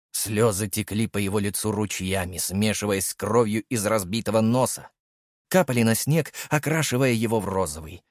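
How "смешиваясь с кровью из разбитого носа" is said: usual speaking voice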